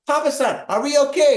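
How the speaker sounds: high, loud mock-alarmed question; panicked pleading. Son-in-law asking Papa-san after tsunami warning, panicked. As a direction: raise the pitch, urgently seek reassurance.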